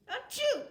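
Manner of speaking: loud, high mock sneeze; fake sneeze. Owner faking a sneeze to amuse the pet, loud mock sneeze.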